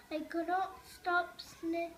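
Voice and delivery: ordinary delivery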